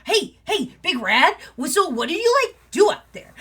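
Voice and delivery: high, loud eager nephew; young wolf pestering his uncle, high and loud; excited young wolf. Acted. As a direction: bounce on his shoulders with questions. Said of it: "is like".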